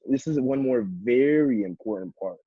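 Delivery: VERY stretched out for emphasis; stress on very. Shown as a demonstration. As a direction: lean hard on the word "very". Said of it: "make sure you're emphasizing the words that are bolded".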